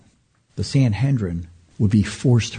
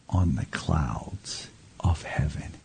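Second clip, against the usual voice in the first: dramatic whisper; 8 semitones lower, 9 dB quieter, slower than usual. Acted. Quoting Jesus, drops to whisper.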